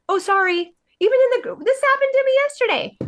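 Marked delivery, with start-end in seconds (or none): 0.1-0.7 high, fluttery oh sorry; mock apology. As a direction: imitate a reflexive apology. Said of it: "indoctrinated to say".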